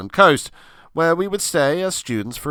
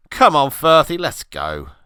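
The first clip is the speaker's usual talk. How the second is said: louder call to a mate; 4 semitones higher, 6 dB louder than usual. Acted. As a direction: call him over loudly. Calling out to friend.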